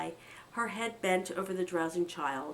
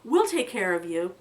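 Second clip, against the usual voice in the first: louder, sudden declaration; sudden exclaim; 8 dB louder than usual. Acted. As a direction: burst out protectively. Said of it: "Philip exclaimed suddenly".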